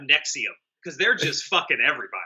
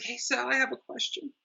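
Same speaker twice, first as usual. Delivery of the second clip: mock soft sensitive question, much higher; 8 semitones higher, 9 dB quieter than usual. Mocking Leo asking Sal timidly, soft high mock voice. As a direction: ask it meekly and softly.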